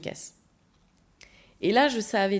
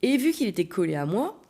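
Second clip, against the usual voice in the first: normal talking voice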